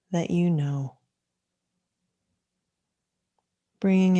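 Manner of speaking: unhurried meditation guidance with long pauses; calm, measured mindfulness teacher leading a practice; guided meditation. Acted. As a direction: guide listeners gently into stillness, leaving space between phrases.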